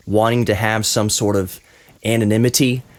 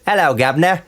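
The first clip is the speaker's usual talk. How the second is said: higher-pitched mock Cockney greeting; 6 semitones higher than usual. Shown as a demonstration. Host putting guest on the spot to mimic, mock Cockney. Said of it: "That was a stereotypical Cockney accent".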